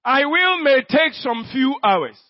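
usual speaking voice

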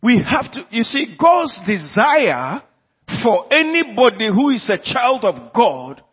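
impassioned sermon delivery; fervent preaching. Acted. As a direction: press the point home to the church. Preaching at full force.

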